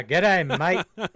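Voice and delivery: loud hammed-up Aussie g'day; mock Aussie greeting. Joking with co-host, a loud mock Australian greeting. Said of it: "our Aussie impressions".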